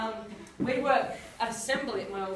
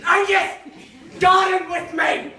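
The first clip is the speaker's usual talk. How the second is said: mock furious yell at Angus, higher and louder; mum's rage; 8 semitones higher, 13 dB louder, slower than usual. Mum yelling at Angus to garden, mock rage. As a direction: bark the order like an enraged mum. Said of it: "her occasional rage fit".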